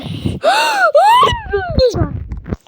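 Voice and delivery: long high shouted gasp. Real, not acted. Player startled by something onscreen, long high gasp. Shocked in game.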